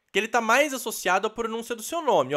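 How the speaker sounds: usual speaking voice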